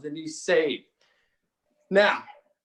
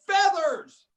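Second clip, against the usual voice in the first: high falling cry, only mildly louder; storyteller's half-scream, pitched way up; mock scream; 10 semitones higher, 3 dB louder than usual. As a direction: call it out as if falling. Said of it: "scream a word".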